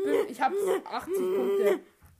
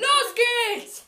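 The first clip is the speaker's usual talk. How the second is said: excited call; 8 semitones higher, 7 dB louder than usual. Real, not acted. Starting the game, calling out.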